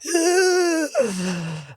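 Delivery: high gasp with a half laugh, half cry; retold horrified gasp. Retelling the con man's casual hello, a horrified gasp. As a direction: gasp like you can't believe he texted.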